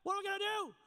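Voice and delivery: high, loud panicked plea; rushing to Elisha in alarm; flip out mode. Retold or imitated. You inside running to Elisha, scared and panicking. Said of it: "inside you're running up to Elisha".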